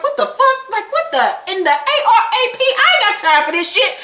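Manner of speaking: high, fast mock-shocked outburst; hammed-up what-the-hell; imitating a stunned man; shocked disbelief. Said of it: "Peter's like".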